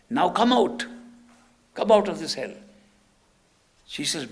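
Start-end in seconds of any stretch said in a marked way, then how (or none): 0.1-2.5 loud, raised-pitch order; acted firm, urgent command. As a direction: summon someone back from a trance. Master commands her to return.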